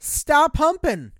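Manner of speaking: loud, high, drawn-out exasperation; mock scolding outcry. Host telling the Dalmatian parents off, mock scolding. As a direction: yell it slowly in joking reproach.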